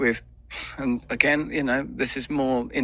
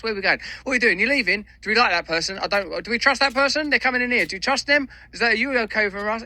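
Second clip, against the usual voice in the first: much higher, eager, loyal dog voice; a devoted dog fussing over his owner, pitched way up; Bear the dog talking; 11 semitones higher, faster than usual. Pretend. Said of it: "if Bear could talk, this is what Bear would be saying".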